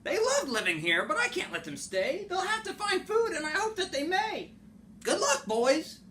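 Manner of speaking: high, sorrowful Lorax voice. Acted. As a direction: raise the voice into the fussy little Lorax. Lorax voice pleading for Bar-ba-loots.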